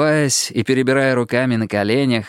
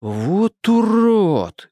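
drawn-out, higher-pitched outrage; sincere indignation; 8 semitones higher, much slower than usual. A narrator's reading. Duty officer reacting to detainee's accusation, slow indignant exclamation. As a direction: stretch it out, genuinely appalled. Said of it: "искренне возмутился дежурный".

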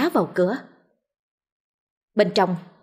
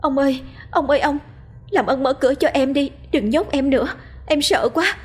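high, fast, frightened begging; terrified pleading; 6 semitones higher than usual. A narrator's reading. Locked-in wife begging husband to open the door, terrified. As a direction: beg through the door, panicking. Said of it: "đưa tay gõ lại vào cửa".

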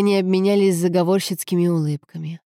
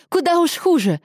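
very high-pitched angry outburst; exasperated, wounded exclamation; furious retort; 11 semitones higher, 3 dB louder than usual. A narrator's reading. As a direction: snap back in outrage. Furious retort in a lovers' quarrel.

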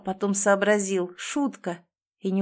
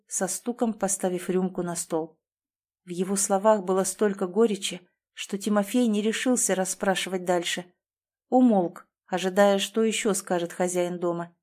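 plain reading voice

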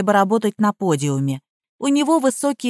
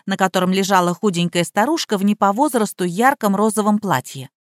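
plain reading voice

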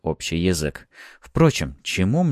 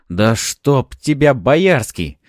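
loud, raised, drawn-out curse; angry exclamation; 6 semitones higher, 6 dB louder, much slower than usual. A narrator's reading. Fyodor jumps up cursing Boyarsky, angry exclamation. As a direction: curse him out in frustration. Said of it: "снова воскликнул он".

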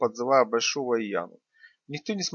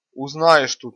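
loud retort; 9 dB louder, slower than usual. A narrator's reading. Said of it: "откликнулся Мишка".

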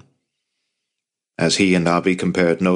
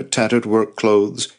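ordinary delivery, nothing marked

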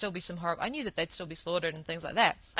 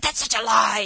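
louder retold outburst, sharply indignant; furious denial; 7 dB louder than usual. Man scoffing at the calf slaughter claim, retold angry outburst. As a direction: imitate his angry brush-off. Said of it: "he was furiously in denial".

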